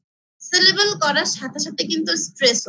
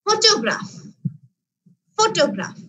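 Photograph with a higher, punched first syllable ফ; stress on first syllable; 4 semitones higher than usual. Shown as a demonstration. Teacher shows class where stress falls, first syllable of photograph stressed. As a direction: hit the opening ফ of photograph hard, then let it fall. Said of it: "ফটোগ্রাফির প্রথম সিলেবলে আমি কি করছি".

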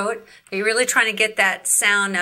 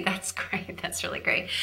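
whisper; 2 semitones lower, 10 dB quieter than usual. Real, not acted. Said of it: "teacher whispers praise".

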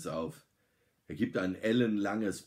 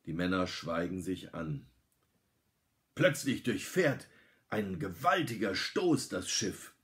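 ordinary delivery, nothing marked

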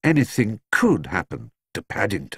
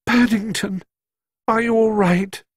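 anxious; 12 semitones higher than usual. A narrator's reading. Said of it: "hardly daring to breathe".

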